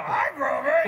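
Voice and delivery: high, loud cartoon-like hello; stage persona voice. Shown as a demonstration. Comic showing his act resembles the puppet, high voice. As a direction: belt the greeting close to a puppet's voice. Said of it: "it's really close".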